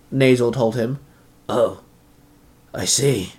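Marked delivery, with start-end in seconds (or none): 1.5-3.3 lower, softer sigh; deflated, quiet understanding. A narrator's reading. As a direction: sigh it out softly. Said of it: "Zanaku sighed".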